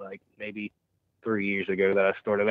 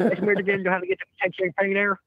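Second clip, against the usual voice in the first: high, fast, garbled mumbling stranger; a cowboy asking directions in nonsense mumbles; Ed Bassmaster mumbles voice; 8 semitones higher, 3 dB louder, faster than usual. Retold or imitated. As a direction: rattle off half-sensible words to confuse. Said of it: "talking to people like that".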